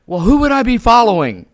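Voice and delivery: high, loud mock-alarmed outburst; panicked survival mode. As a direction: blurt it out in exaggerated self-concern. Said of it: "now I go into survival".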